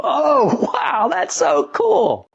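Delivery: high, slow, wide-eyed wonder; amazed wow. Pretend. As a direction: draw out the wow in delight. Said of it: "And you go".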